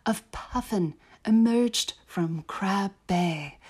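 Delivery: slow, put-on British accent veering Aussie; measured amateur British accent with Australian drift; British accent, slightly Australian. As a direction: draw it out in wobbly British-Australian. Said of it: "It got a little Australian".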